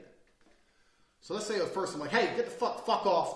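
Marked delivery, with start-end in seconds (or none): 2.1-3.4 mock aggressive shout. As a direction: bark a loud, higher-pitched warning. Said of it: "I've been aggressive".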